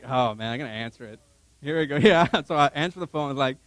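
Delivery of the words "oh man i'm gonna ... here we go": low, resigned self-talk; dreading. Retold or imitated. Husband steeling himself to answer wife's call, dreading. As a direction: brace yourself under your breath, low.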